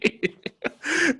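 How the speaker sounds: high-pitched laughing fit; breathless high giggle; real laugh. Real, not acted. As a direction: keep cracking up. Laughs at own punchline.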